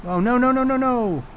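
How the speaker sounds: high, rapid-fire string of no's; real dismay. Real, not acted. Streamer hit by PC trouble, real dismay. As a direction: plead with a misbehaving computer.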